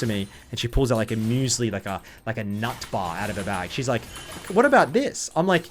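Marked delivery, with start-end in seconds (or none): 4.5-5.3 higher, innocent mock-asking voice; imitating the girl. Retelling the Austrian girl on the plane, innocent mock question. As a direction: lightly play the naive passenger.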